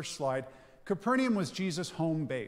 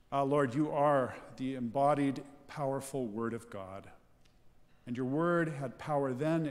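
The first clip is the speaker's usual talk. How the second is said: prayer; 3 semitones lower than usual. Real, not acted. Leads congregation in prayer.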